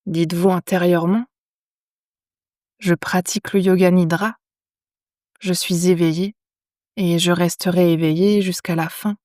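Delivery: calm yoga nidra guidance with long pauses; unhurried, measured phrases, leaving silence after each instruction; guided meditation. Acted. Teacher guiding lying listeners into yoga nidra, calm meditation voice.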